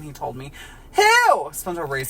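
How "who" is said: very high, loud squeal of a word; high shrieked who. Acted. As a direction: shriek the question. SpongeBob shouting who.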